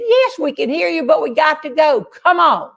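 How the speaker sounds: high-pitched retold holler back; impatient calling. Canoe group answering the man on the road, calling out impatiently.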